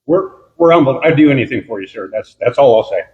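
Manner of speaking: ordinary talk, nothing marked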